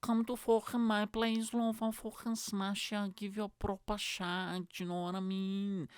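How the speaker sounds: high, drawn-out Scouse chat-up; comic Scouse lad, slow and high; Scouse accent. Acted. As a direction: put on a high Scouse voice for a cheesy come-on. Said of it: "why is everything in Scouse accent".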